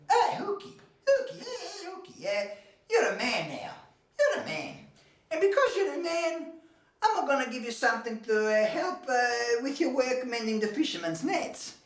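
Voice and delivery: high, laughing, tearful motherly praise; proud mother. Acted. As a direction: beam with pride at her grown son. Mother on son's birthday.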